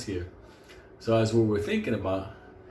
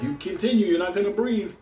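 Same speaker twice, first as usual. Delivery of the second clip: higher-pitched acted warning; mock threat; 6 semitones higher than usual. Friend threatens the dog he holds by the belt, mock threat. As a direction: warn it in a raised, put-on voice.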